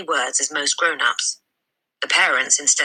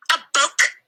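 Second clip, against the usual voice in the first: high, scoffing outburst; incredulous father; 12 semitones higher than usual. Acted. Father sneers at Matilda's request, high and incredulous. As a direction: scoff at the very idea. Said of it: "he said".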